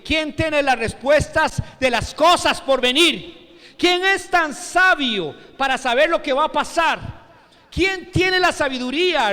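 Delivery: loud, rising proclamation; forceful preaching. Acted. Preacher proclaiming Isaías to the congregation, forceful declamation. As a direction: drive the rhetorical questions home at full voice.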